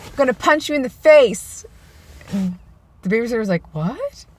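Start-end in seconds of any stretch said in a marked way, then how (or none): 0.1-1.7 high, loud playful threat; mock threat. Niece to her babysitter, gleeful-sounding mock threat. 3.7-4.3 high, rising questioning surprise; puzzled disbelief with a rising end; taken aback. Retold or imitated. Babysitter to niece after a threat, taken aback.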